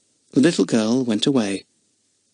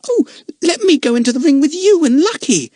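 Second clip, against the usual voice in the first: much higher, excited plea; begging eagerly; 5 dB louder than usual. Acted. Lotta begging to join the ring act, high and excited. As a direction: bounce with eagerness as you beg. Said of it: "begged Lotta".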